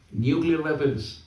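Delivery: ordinary talk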